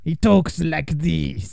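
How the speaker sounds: put-on slow, croaky French-sounding old bridge keeper; creaky old-timer, words dragged out; croaky old man. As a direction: croak it out slowly with an accidental French accent. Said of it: "when I do the croaky voice".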